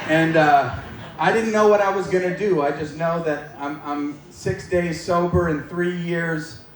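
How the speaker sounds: usual speaking voice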